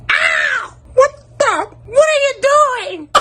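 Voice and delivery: very high, squealing outraged teacher voice; high-pitched mock teacher squeal; shrieking teacher. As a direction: play the startled teacher, yelping up high. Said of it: "Miss Keen was like".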